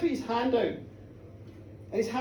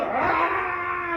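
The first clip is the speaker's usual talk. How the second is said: put-on drunken roar; 6 semitones higher than usual. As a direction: bellow at your mate like a brawling lad. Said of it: "they're roaring each other".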